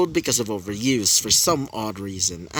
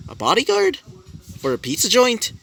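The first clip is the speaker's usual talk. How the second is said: high-pitched, puzzled echo of the offer; incredulous question; 9 semitones higher than usual. Acted. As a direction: question it in disbelief, voice jumping up. Acted character questioning a job offer.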